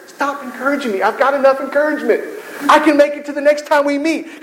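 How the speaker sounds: high, fed-up protest; mock weariness. Worn-out believer begging the encouragers to stop, high and fed up. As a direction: wave off too much encouragement.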